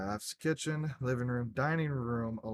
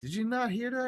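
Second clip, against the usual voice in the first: much higher, retold disbelief; incredulous; 12 semitones higher, 3 dB louder, faster than usual. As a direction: ask it like you can't believe he missed it. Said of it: "I'm like".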